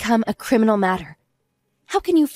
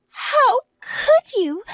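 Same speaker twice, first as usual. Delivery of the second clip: anguished wail; 12 semitones higher, much slower than usual. Acted. Humiliated, rumors spread.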